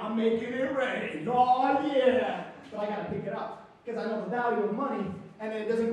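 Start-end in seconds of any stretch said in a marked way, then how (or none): 0.0-2.4 loud, drawn-out celebration; hyped-up bragging. Acted. Kid flaunting play money.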